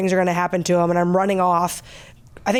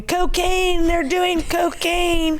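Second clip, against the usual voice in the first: high shouted cry of alarm; imitating strangers yelling cocaine; mock yell; 7 semitones higher, much slower than usual. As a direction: yell it like panicked bystanders. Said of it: "people from outside are screaming".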